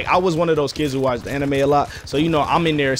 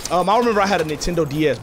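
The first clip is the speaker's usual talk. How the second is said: ordinary delivery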